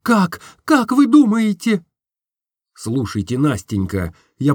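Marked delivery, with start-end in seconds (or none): 0.0-1.8 high, urgent question; anxious young woman asking; Nastenka anxious. Acted. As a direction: press him for an answer. Nastenka asks him.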